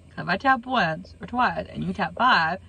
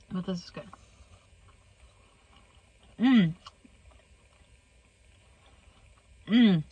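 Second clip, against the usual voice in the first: talking while chewing; muffled by food in mouth; mouth full of taco. Real, not acted. Seen 0: Repeated bites of soft taco, chewing between words throughout.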